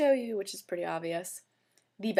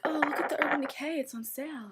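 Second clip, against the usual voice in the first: higher, eager mock boyfriend; excited boyfriend; 7 semitones higher than usual. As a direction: play him pointing out a sale, excited. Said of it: "he can go into Sephora and be like".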